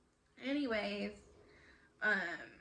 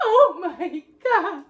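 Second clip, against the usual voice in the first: slow, much louder, higher laugh-cry; crying imitation of mom; 9 semitones higher, 25 dB louder, much slower than usual. Daughter mimics mom sobbing at the news, slow laughing cry. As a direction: wail it as mom breaking into tears. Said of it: "she just fell out".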